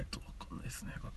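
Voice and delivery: hushed aside, no voice; whispered aside. Acted. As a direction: breathe it out as an aside. Staff mutters aside.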